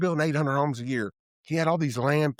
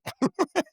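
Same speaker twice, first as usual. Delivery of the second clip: laugh. Real, not acted. Laughs at story.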